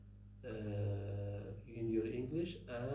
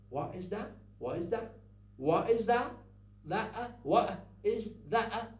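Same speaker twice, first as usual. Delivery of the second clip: "What is that?" with t cut off in the throat; rising, clipped American "What is that?"; lively American question with glottal t; American glottal stops; 8 semitones higher, 6 dB louder than usual. Shown as a demonstration. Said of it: "I say".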